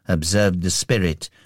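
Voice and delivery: ordinary delivery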